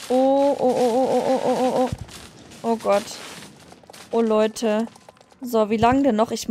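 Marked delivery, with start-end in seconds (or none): none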